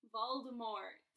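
loud, firm shout of a name; bellow. A narrator's reading. Harry shocking weeping Hagrid into silence, bellowing. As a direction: bellow it out boldly. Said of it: "Harry bellowed".

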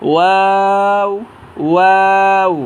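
ওয়াও stretched very slowly; rounded lips. Shown as a demonstration. Teacher models a letter for learners, slow with rounded lips. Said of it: "দুই টুট গুল করিয়া".

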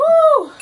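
high, loud whoop; spice-burn whoop. Real, not acted. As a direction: let out a piercing "woo" from the heat. Reacting to burning spice.

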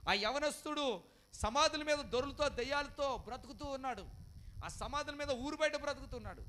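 loud, high, urgent sermon delivery; full-force preaching. Acted. Pastor warning the congregation, loud and high. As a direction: preach it out over the crowd.

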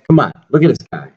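ordinary talk

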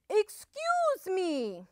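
high, drawn-out singsong; sweet call. A narrator's reading. Wolf at Missus Duck's door, calling sweetly. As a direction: call out sweetly, stretching the words. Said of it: "he called sweetly".

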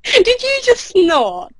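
high, laughing question; amused teasing. Real, not acted. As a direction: tease her about the snort. Teasing about a snort.

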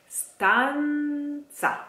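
stanza sung out high, ending in tsa; stanza like speranza. Shown as a demonstration. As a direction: stanza with a crisp tz. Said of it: "Stanza is like speranza".

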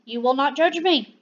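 usual speaking voice

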